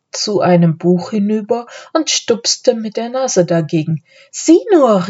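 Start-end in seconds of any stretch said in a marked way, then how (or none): 4.3-5.1 high-pitched eager call; excited child exclaiming; calling out excitedly. A narrator's reading. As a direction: draw their attention with a high cry. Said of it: "rief Anne".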